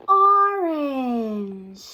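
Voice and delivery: gasp, then a bright reveal; delighted gasp. Acted. Reader gasps as she reveals the salamander's color. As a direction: discover the color with wonder.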